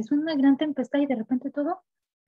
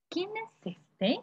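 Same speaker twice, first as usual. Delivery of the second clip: high, slow and softer, full of awe; awed wonder; 9 semitones higher, 6 dB quieter, much slower than usual. Acted. Disciples asking each other who Jesus is, hushed and awed. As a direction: ask it slowly in hushed amazement.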